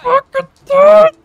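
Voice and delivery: very high, loud drawn-out shout; real strained roar of exertion; straining yell. Real, not acted. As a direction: yell it out with all your effort. Straining in arm-wrestle button mashing.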